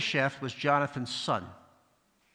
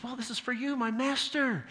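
fawning servant; 10 semitones higher than usual. Retold or imitated. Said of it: "He says".

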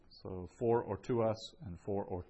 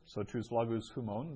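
normal talking voice

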